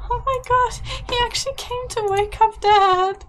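high, loud gasping disbelief; gasp, then squeal it in delighted amazement; amazed squeal. Real, not acted. Player seeing the child come to wake up Dad, amazed squeal.

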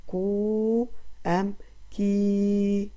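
كو and كي each stretched long and slow; slow teacher sounding-out; drawn-out syllables. Shown as a demonstration. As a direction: sound out كو then كي very slowly. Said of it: "هل نختار له المقطع".

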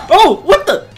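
high, loud burst of surprise; startled. Real, not acted. Player surprised by the next boss, startled yelp. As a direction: jolt upward as if caught off guard.

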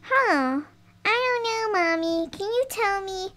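a hint of a confused toy daughter; doll's puzzled voice. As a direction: sound unsure, like a small child. Doll answers Mama's math question.

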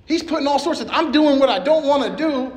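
normal talking voice

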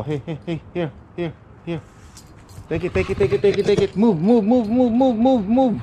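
high, rising cheers at the dog; excited urging. Real, not acted. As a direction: hype the dog up to move.

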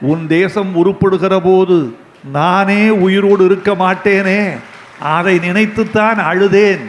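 normal talking voice

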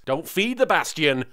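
ordinary talk, nothing marked

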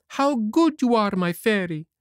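put-on boyish Pinocchio voice. A narrator's reading. To the fairy, Pinocchio speaks in a high boyish voice.

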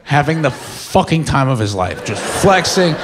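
ordinary delivery

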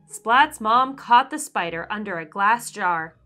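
ordinary delivery

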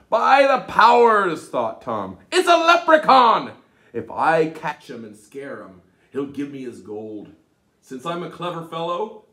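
loud, eager exclamation; excited Tom. Acted. Tom thrilled at spotting a leprechaun, loud excitement. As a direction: burst out with greedy glee. Tom's excited thought voiced.

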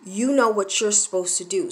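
ordinary talk, nothing marked

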